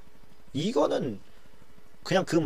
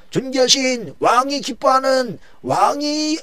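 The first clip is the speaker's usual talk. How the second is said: high, slow ringing proclamation; a herald calling out as he leads the horse; herald's cry; 13 semitones higher, 4 dB louder, slower than usual. Acted. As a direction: announce it to the crowd. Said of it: "이런 거예요".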